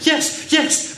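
high, loud eager yes; eagerly. A narrator's reading. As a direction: blurt it out eagerly. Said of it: "they answered eagerly".

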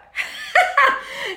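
real high laugh, no voice; laughing at the rude comment; high breathy laugh. Real, not acted. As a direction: burst into an airy laugh.

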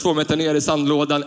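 ordinary talk

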